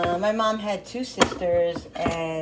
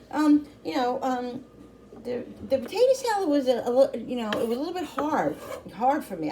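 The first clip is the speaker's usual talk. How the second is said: hesitant, higher imitation of her son; a lightly voiced son, choosing his words; son's honest critique; 7 semitones higher than usual. Mom voicing her son's gentle complaint, a bit higher.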